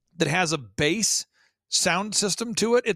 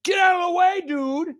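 high-pitched mock yell at nobody; imitated yelling; 12 semitones higher, 4 dB louder than usual. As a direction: imitate a man hollering at thin air. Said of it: "he'll yell things just randomly".

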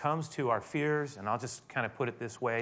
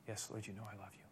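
much softer, humbled answer; hushed Peter reply; 3 semitones lower, 16 dB quieter than usual. Acted. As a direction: answer in a low hush.

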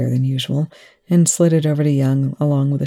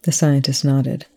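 plain reading voice

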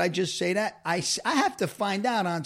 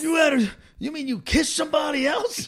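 high outraged disbelief, raised voice; jealous outburst; 10 semitones higher, 4 dB louder than usual. Retold or imitated. Husband replays catching her past kiss, high and outraged. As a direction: hurl the question in mock shock.

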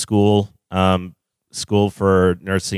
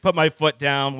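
normal talking voice

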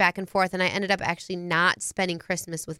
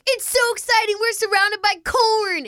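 high, loud fake thrill; mock excitement; 13 semitones higher, 4 dB louder than usual. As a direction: gush over the corn like a giddy mom. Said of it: "here's me, and I'm like".

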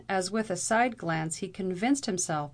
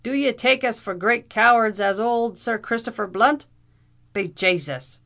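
loud, higher Irish outburst; indignant bluster; 4 semitones higher, 8 dB louder than usual. A narrator's reading. Captain offended at being taken for cowards, indignant. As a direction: demand it loudly, deeply offended.

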